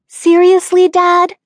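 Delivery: high, loud whiny complaint; complaining. A narrator's reading. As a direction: protest like a teen. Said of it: "I complained".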